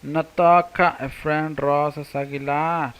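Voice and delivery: slow, loud Notoka before the name; Nahuatl introduction. Shown as a demonstration. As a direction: model Notoka slowly for the class. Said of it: "Mi nombre es".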